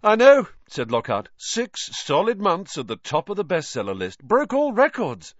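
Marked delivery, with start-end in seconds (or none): 0.0-0.5 very loud, high delighted exclamation; gushing. A narrator's reading. Vain author agreeing about his success, gushing. 1.4-5.3 high, excited bragging, rising louder at the end; boastful. A narrator's reading. Vain author boasting of his bestseller, gushing.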